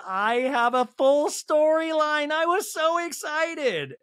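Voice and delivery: high, slow, dreamy mock Angie. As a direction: gush it up high and slow like a giddy housewife. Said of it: "And she's like".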